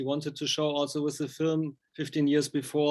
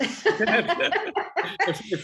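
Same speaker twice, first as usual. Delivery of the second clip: laughing; 8 semitones higher, 4 dB louder than usual. Real, not acted. Amused at the cat lover and his dog Felix, laughing through the name. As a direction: say the name through a real laugh, pitched up high.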